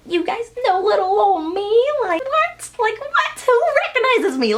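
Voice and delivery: very high, squeaky, amazed; giddy disbelief. Retold or imitated. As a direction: gush like you can't believe it. Disbelief at being recognized.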